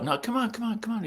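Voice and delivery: high-pitched imitated coaxing; insistent urging. Manager steering the writer outside, insistent urging. Billy urging him toward the door.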